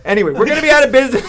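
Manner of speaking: laughing while saying it; a fast laugh through the words. Real, not acted. Laughing doom prediction.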